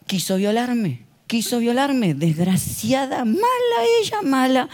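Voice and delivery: slow, wailing false accusation, rising to a sob; mock crying accusation. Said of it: "Y empezó a gritar".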